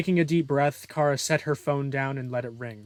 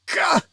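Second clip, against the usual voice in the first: high, loud yelp of pain; pained cry; 8 dB louder than usual. Acted. Villain losing his arm, high pained yell. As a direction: cry out as if struck. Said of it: "he cried".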